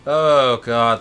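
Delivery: ordinary delivery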